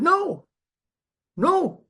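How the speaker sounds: insistent no. Retold or imitated. Sponsor insisting he keep reading.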